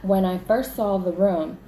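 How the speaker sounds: usual speaking voice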